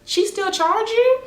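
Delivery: high, curious mock voice; imitating friend. Friend asking the client about the charges, high mock voice.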